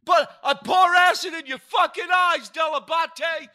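very high, loud, ranting shout; mock death threat. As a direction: scream the threat like a lunatic. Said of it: "threatening death to me".

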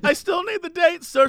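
high, insistent cashier voice; lightly voiced pushy store clerk; older cashier lady. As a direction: pitch it up as the fussy cashier. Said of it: "has a German accent".

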